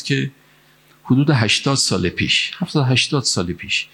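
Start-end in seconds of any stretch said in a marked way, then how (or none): none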